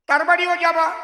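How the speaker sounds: তার বাড়িও যাবা pushed up high and loud; urging the flock, voice lifted high; emphatic command. Real, not acted. Repeated instruction, loud then soft.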